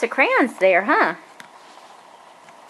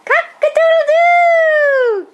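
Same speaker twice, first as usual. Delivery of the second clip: high, loud, slow cock-a-doodle-doo; rooster crow; 5 dB louder, much slower than usual. Shown as a demonstration. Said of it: "How about a rooster?".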